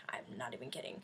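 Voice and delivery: hushed aside; whisper. Real, not acted. Vlogger insisting to viewers, whispered. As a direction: whisper it to the camera. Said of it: "aside whispered to viewers".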